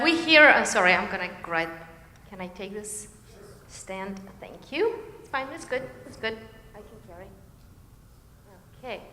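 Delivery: ordinary talk, nothing marked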